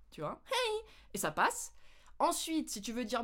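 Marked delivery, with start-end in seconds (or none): 0.5-0.8 much higher short Hey; high little noise. Shown as a demonstration. Showing the quick high greeting sound. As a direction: squeak a tiny high Hey. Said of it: "un bruit un peu aigu".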